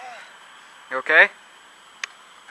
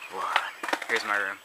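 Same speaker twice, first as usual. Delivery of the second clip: normal talking voice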